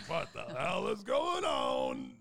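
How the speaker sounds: loud, slow, laughing disbelief; shocked outcry. Retold or imitated. Retelling the doctor's news, a shocked laughing outcry. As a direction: yell it out while laughing.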